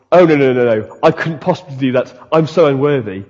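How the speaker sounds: lightly put-on false humility, falling away; mock pious. Preacher mimicking people being Christianly pious, mock humble. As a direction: fake a modest, pious refusal. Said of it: "Christianly pious going".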